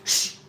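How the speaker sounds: drawn-out librarian shush; long shush. Pretend. Streamer playing librarian, shushing everyone. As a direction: hush the room with a shh.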